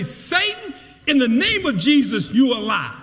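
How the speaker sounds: commanding rebuke, Satan called out high; preacher demonstrating a rebuke; rebuking Satan. Shown as a demonstration. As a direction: rebuke the devil boldly. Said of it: "you need to say".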